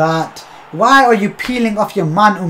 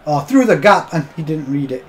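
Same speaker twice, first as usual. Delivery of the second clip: usual speaking voice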